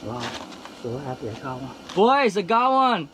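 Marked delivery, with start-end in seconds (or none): 1.9-3.1 loud, high, triumphant call; excited shout. Real, not acted. Calling out to friends.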